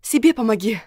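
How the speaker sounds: loud angry snap; snapping back. Acted. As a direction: bite back, offended. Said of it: "огрызнулась я".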